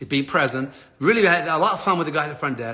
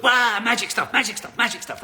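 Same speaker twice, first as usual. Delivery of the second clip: higher, playful conjuring patter; mock wizardry; 6 semitones higher than usual. Desk agent fixing the ticket, playful mock-magic patter. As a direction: imitate someone working magic, pitched up.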